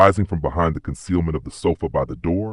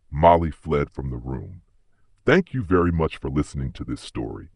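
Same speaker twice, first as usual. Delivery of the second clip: ordinary delivery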